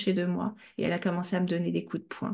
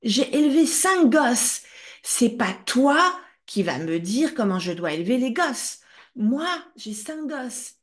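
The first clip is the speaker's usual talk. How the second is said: put-on mother yelling at her child about raising five kids; furious yell; 4 semitones higher, 5 dB louder than usual. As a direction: hurl it back as the offended mother. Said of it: "qu'elle s'est énervée et qu'elle a hurlé".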